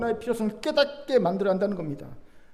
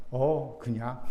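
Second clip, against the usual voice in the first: low, casual noncommittal reply; laconic Chungcheong villager; 6 semitones lower, 3 dB quieter, much slower than usual. Acted. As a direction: brush the question off mildly, pitched down. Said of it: "충청도 사람들은요, 이런답니다". (낮고 심드렁한 충청도 사람 말투)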